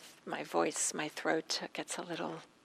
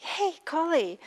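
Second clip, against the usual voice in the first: higher, louder mistress calling out; exasperated call; 9 semitones higher, 7 dB louder than usual. Retold or imitated. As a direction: summon the late maid with impatience. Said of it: "this exasperation".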